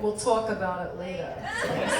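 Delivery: laughing tease. Real, not acted. Teasing fellow Jersey panelist.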